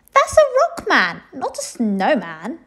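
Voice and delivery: high, loud, disdainful; scornful princess. A narrator's reading. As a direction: sneer at the rock man. Princess scorns stone snowman.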